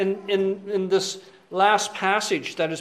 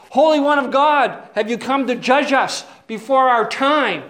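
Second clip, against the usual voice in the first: loud, high cry of possessed spirits; 5 semitones higher, 7 dB louder than usual. Retold or imitated. Possessed people crying out to Jesus, loud. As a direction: shout it out like the possessed. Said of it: "they cried out".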